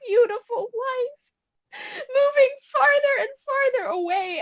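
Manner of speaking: crying despair. Acted. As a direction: sob it out in despair. Irina cries out in despair.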